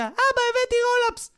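put-on very high, squealed kid voice; child coming home. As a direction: squeal it to dad like a small child. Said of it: "היום ילדים נכנסים הביתה". (קול ילד צווחני וגבוה)

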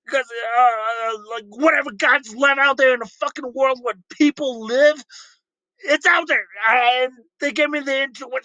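high slurred angry grandpa; Rick voice. Pretend. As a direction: drunk ranting old scientist. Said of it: "my interpretation of Rick and Morty arguing".

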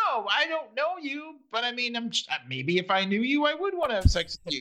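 high, halting protest that tumbles onward; mock flustered denial. Caller correcting the receptionist's assumption, mock flustered. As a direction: imitate someone backpedalling awkwardly. Retold caller awkwardly backpedalling.

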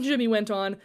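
ordinary delivery, nothing marked

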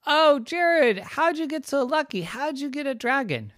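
put-on high, eager child voice; high child voice, wide-eyed envy; envious kids. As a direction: squeak it like a jealous little kid. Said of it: "other boys and girls were so envious".

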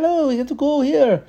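very high put-on grandpa voice; foreign grandpa. Grandpa tells the kids it's time to go, high theatrical voice. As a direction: imitate a genie-like foreign grandpa. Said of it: "he's, like, foreign to their speak".

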